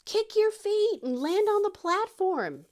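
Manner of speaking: high, slow call across a distance; imitated distant yell. Husband yelling landing instructions from the other side, calling out. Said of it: "my husband's yelling from the other side".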